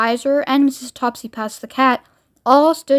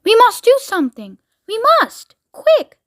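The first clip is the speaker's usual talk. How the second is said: high-pitched, panicky urging; urgent alarm; 10 semitones higher than usual. Acted. Someone urging action as the countdown runs, urgent alarm. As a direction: cry out for action, high and pressed.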